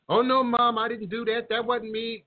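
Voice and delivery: put-on high, whiny child voice; whiny kid denial. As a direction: play a kid caught lying. Problematic child denying to mother.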